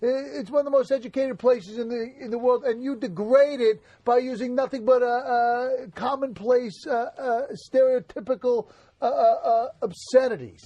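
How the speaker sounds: mock-offended Brooklyn accent. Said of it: "Do a fucking Brooklyn accent".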